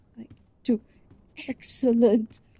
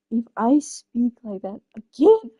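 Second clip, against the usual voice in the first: ordinary delivery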